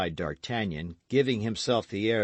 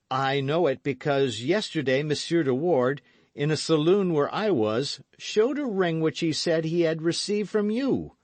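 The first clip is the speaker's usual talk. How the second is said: jealous accusation; 8 semitones higher, 5 dB louder than usual. Acted.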